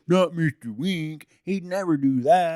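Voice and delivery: high, loud, drawn-out mock protest; mock disbelief. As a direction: imitate scandalized admirers, hammed-up and loud. Voicing disbelieving townspeople, mocking.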